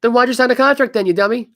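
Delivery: calling out a player who broke his contract, loud and exasperated; exasperated shout. Real, not acted. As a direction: yell it like you can't believe him. Ranting at the player.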